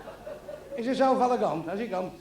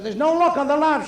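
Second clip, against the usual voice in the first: landlady voice; 8 semitones higher, 8 dB louder than usual. Retold or imitated. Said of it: "she said".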